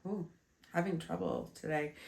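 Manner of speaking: speaking with a blocked nose; stuffy nose. Real, not acted. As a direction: talk through a stuffy, congested nose. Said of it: "You can tell I'm a little stuffy".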